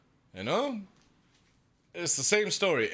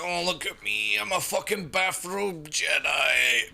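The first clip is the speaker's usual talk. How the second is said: mock braggart; 3 semitones higher, slower than usual. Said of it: "No, he didn't really say it this way".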